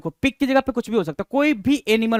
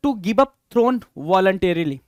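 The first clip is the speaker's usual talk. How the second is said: slow reading aloud; 3 semitones lower, much slower than usual. Shown as a demonstration. Teacher reading question two aloud to the class, slowly. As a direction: question read out slowly, word by word. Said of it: "question number टू कह रहा है".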